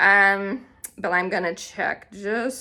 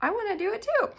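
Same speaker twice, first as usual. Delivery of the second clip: much higher, eager self-quote; 13 semitones higher, much faster than usual. Retold or imitated. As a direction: chirp it like an excited fan. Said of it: "So I was like".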